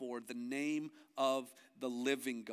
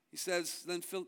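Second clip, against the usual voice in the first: usual speaking voice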